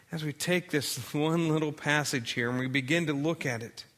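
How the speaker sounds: soft, hushed prayer voice; bow the head and pray quietly. Acted. Preacher leading the congregation in prayer, soft and hushed.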